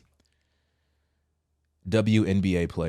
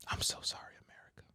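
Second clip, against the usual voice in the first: mock whisper; 17 dB quieter, faster than usual. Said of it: "She said".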